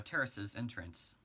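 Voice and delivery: ordinary delivery, nothing marked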